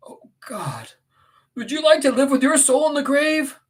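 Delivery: high, loud, impassioned reproach; anguished Heathcliff, accusing. Acted. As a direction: accuse a dying lover in agony.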